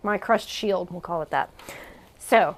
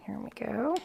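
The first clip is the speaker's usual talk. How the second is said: soft unvoiced whisper; 2 semitones lower, 8 dB quieter than usual. Real, not acted. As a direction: whisper it close to the mic. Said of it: "whispered opening".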